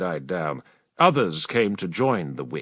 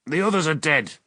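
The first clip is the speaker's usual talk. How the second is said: machine-thin voice; 5 semitones higher than usual. Acted. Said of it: "he reported in a machine-thin voice".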